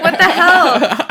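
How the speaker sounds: slow, loud, high and laughing; mock outrage at the fake wiki fact; shocked. Reading false claims on her Wikipedia, loud mock shock. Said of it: "you're like".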